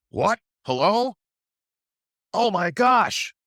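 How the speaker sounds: high, loud fake surprise into the bottle; mock excited. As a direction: play up the shock of the fake call, loud and high. Trader fakes a phone call excitedly.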